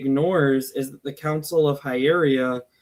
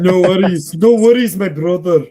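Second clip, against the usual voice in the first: bro voice; 4 semitones higher, 9 dB louder than usual. Pretend. Playful bro voice, loud.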